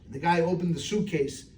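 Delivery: ordinary talk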